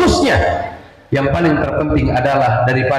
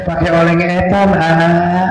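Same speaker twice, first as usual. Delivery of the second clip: put-on cadel baby talk; slower than usual. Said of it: "Kata bininya teh".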